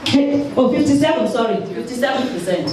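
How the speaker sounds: usual speaking voice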